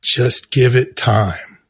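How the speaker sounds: low, slow, soothing; calm publicist. Retold or imitated. The publicist calming him, low and slow. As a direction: talk him down gently and slowly.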